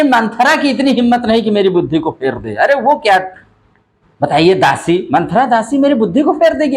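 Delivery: lightly acted queen in high-pitched protest; Kaikeyi. Teacher voicing Kaikeyi scoffing at blaming her maid, lightly acted protest. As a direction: raise the voice in pitch, retelling a queen's disbelief. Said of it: "Kaikeyi कह रहे".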